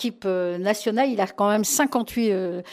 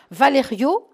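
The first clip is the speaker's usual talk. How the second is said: ordinary delivery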